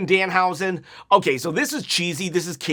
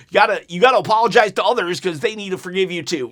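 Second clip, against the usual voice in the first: normal talking voice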